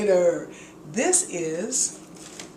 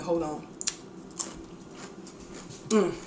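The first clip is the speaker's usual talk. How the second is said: normal talking voice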